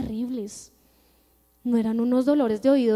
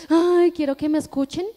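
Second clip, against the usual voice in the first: mock whiny cry; 4 semitones higher, 6 dB louder than usual. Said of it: "pero no con ese llanto de".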